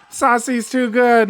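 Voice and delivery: excited. Real, not acted. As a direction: savour the play with awe. Caster repeats it, hyped.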